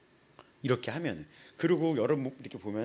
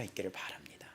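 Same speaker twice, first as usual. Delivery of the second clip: hushed confiding whisper; 7 semitones lower, 14 dB quieter than usual. Real, not acted. Preacher urging the congregation to think, hushed whisper.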